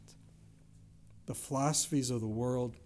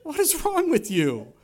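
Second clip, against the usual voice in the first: imitating mom; 11 semitones higher, 6 dB louder than usual. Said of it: "She was like".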